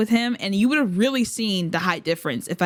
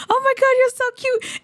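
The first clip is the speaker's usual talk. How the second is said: much higher, louder gushing squeal; 4 dB louder than usual. Retold or imitated. Fan re-lives gushing at her idol, high squeal. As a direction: squeal it out, totally smitten.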